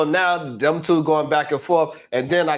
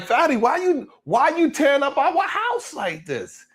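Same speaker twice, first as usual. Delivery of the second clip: high plaintive whine; 9 semitones higher than usual. Retold or imitated. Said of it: "so Ali was like".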